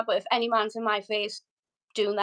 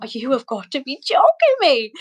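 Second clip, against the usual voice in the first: loud retold exasperated disbelief; incredulous outburst; 3 semitones higher, 6 dB louder than usual. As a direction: explode in disbelief at being locked out. Retelling being locked out.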